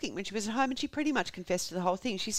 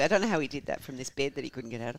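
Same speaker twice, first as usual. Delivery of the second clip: ordinary talk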